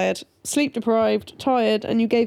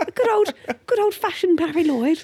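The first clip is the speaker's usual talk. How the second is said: high-pitched send-up of very English speech; mock English accent; 6 semitones higher than usual. Mimicking the partner's bad Welsh pronunciation, mock English accent. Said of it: "That's what you sound like".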